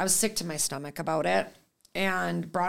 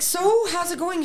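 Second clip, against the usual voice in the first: high-pitched retold question; tense, lifted phone voice; anxious small talk; 10 semitones higher than usual. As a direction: feign casual while dreading the news. Retelling anxious wait for results.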